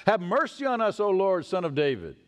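crying out. Retold or imitated. Blind men calling to Jesus as he passes, crying out. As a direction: call out for mercy. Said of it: "cried out".

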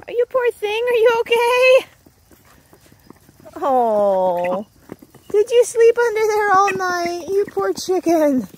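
high, loud, tender pet talk. Real, not acted. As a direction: comfort the frightened hen. Finding hen under bucket.

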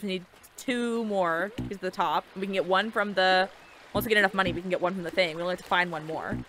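usual speaking voice

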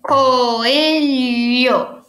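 "Coelho" joined up but greatly stretched; slow, sustained teacherly "Coelho"; drawn-out syllables. Shown as a demonstration. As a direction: linger on every syllable of "Coelho". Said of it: "é só pra gente separar".

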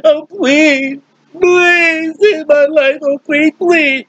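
high, crying plea for his life; Haman begging. Acted. Haman pleads with the queen for his life, crying and high.

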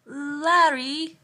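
loud, high, delighted name call; sudden call. Acted. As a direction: break off and cry out the name. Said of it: "she called out suddenly".

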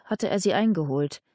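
usual speaking voice

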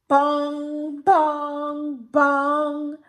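loud booming clock strikes; clock bongs. A narrator's reading. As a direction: boom like a big clock.

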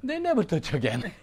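high, loud fervent preaching; preaching at full force. Acted. Preacher thundering at the congregation, high and loud. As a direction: drive it home to the congregation.

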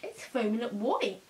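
talking through foamy toothpaste; speaking after brushing teeth; mouth full of toothpaste foam. Real, not acted. Seen 3: Brushed earlier; foam dripped at -1; mouth still foamy while talking.

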